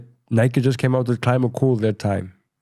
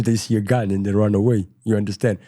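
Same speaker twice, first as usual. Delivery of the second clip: usual speaking voice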